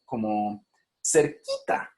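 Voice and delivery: cerquita stretched slowly with a rising tune; demo of cerquita. Shown as a demonstration. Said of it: "yo puedo decir cosas como".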